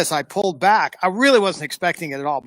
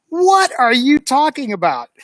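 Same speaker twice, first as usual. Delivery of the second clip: indignant retort; 8 semitones higher, 4 dB louder than usual. Retold or imitated. Arguing with a lecturing detective.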